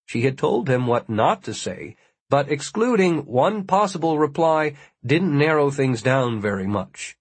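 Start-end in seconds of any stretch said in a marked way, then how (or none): none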